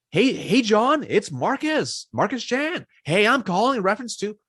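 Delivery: high, singsong fake-friendly salesperson; mock the eager salesy caller, way up high. Shown as a demonstration. Salesman mocks the wrong salesy voicemail, high and chirpy. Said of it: "I'm not saying".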